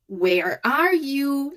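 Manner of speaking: very slow, singsong "Where are you"; drawn-out, teasing-tune "Where are you"; hide-and-seek call. Shown as a demonstration. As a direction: stretch out "Where are you" as if seeking someone hiding. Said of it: "Like when you play hide and seek".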